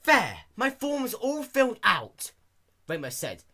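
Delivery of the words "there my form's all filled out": slow, high and loud triumphant; pleased Dash. A narrator's reading. Dash handing the form to the clerk, slow triumphant. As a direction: announce it proudly.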